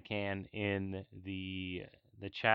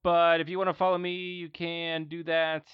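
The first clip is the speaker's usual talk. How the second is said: high playful voice; 9 semitones higher, 8 dB louder than usual. Pretend. Host pitches himself playfully.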